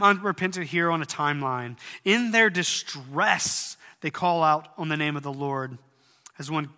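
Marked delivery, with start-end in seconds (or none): none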